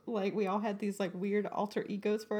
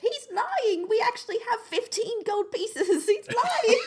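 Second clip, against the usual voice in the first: high, squeaky little tattletale voice; tattling homunculus; 10 semitones higher, 5 dB louder, slower than usual. Acted. Homunculus exposing the haggling to a shopkeeper, high tattling voice. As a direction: blurt it out high and giggly, telling on the boss.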